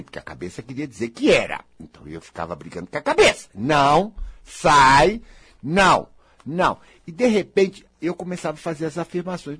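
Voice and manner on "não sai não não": louder, pushing a thought away; forceful refusal. Retold or imitated. Speaker fights his own doubting mind, loud firm refusal. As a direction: shout the doubt out of your head.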